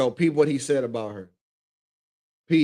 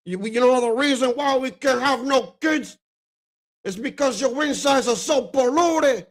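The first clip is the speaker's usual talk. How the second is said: higher, shouted gangster imitation; Scarface quote; 9 semitones higher than usual. Reminding viewers of the Scarface scene, shouted gangster imitation. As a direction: bark the insult like the movie gangster. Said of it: "when he said".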